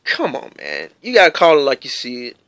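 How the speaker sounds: ordinary delivery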